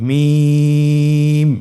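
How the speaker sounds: মিম stretched very long and held with lips closed; drawn-out letter name. Shown as a demonstration. Teacher modelling recitation for students, long drawn-out মিম. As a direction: hold মিম for three counts, thin and loud. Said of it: "আবার বলুন".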